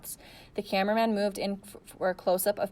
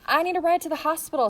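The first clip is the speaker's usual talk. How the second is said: higher, louder, begging for a ride; pleading; 9 semitones higher, 5 dB louder than usual. A narrator's reading. Girl pleading with reporters for a hospital ride, desperate. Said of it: "Sarah pleaded".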